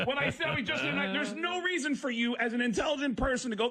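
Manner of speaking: high-pitched, insistent protest; exasperated. Real, not acted. Comic arguing with gullible passengers, exasperated. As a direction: rant upward in amused disbelief.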